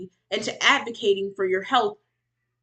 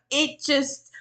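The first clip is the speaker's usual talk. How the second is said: ordinary delivery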